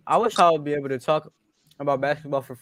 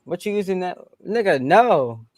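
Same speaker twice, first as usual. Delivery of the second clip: annoyed; 6 semitones higher, 4 dB louder than usual. Real, not acted. Friend grabbing his monitor mid-rap.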